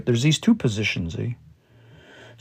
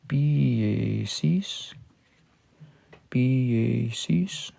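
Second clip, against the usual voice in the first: softer, very slow P A Cs; quiet slow repeat; 7 dB quieter, much slower than usual. Shown as a demonstration. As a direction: say P A Cs softly and slowly, twice. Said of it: "word order doesn't totally matter".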